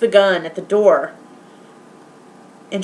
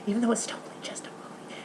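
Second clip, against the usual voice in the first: laughing aside; 17 dB quieter, much faster than usual. Real, not acted. Embarrassed laughing aside after confession.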